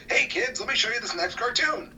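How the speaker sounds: high, loud, peppy host intro; mock kiddie-show host; kids' show host. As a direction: pitch it way up like you're introducing a cartoon to children.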